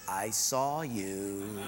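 put-on low, slow menacing girl; sly sister. Sister catches brother, low slow menace. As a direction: drag it out, low and knowing.

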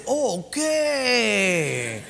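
long drawn-out okay, falling in pitch; mock gung-ho agreement. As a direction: stretch it out, playing the willing fool. Foolishly agrees to the dive.

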